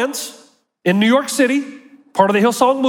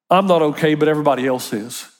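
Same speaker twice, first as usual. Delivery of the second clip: droopy, sinking mock woe-is-me; mock self-pity; 7 semitones lower, faster than usual. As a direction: sigh it out like a self-pitying soul. Mocking the masochist's attitude.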